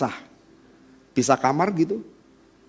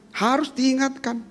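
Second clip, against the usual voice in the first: harus diingatkan diulang lebih lirih; soft echo; 7 semitones higher, 7 dB quieter than usual. Shown as a demonstration. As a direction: ulangi dengan suara pelan. (gema lirih setelah penegasan)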